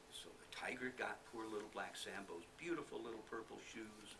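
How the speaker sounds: ordinary delivery, nothing marked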